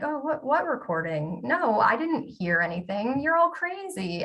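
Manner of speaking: high-pitched mock innocence, pitch leaping on the accusation; feigned bafflement, imitating a brazen cover-up; gaslighting denial. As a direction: play dumb, then turn it on them in a rising voice. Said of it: "I thought about gaslighting".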